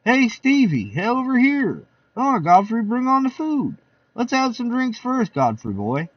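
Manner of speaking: high, lively guest voices; party guests. Acted. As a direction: chatter like a rowdy party guest.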